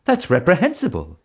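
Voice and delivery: high, indignant mother's voice. A narrator's reading. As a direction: scold like an outraged mother. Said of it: "a mother cried".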